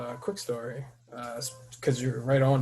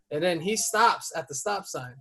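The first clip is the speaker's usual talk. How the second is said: ordinary talk, nothing marked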